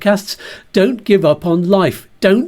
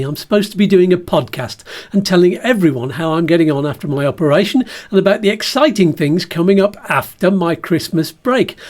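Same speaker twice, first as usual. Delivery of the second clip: usual speaking voice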